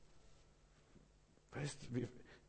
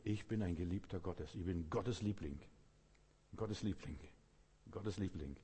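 softer, lower, falling at each end; quiet affirmation; 4 semitones lower, 6 dB quieter than usual. Shown as a demonstration. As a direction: say it softly as a personal assurance. Models affirmation to be repeated.

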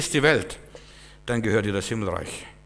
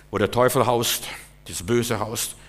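ordinary talk, nothing marked

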